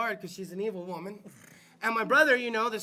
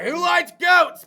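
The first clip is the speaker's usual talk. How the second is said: shouted slowly, much louder, GOATS dropping at the end; mock host shout; 6 semitones higher, 12 dB louder, slower than usual. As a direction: bellow the question like opening a show segment. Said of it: "I was gonna be like".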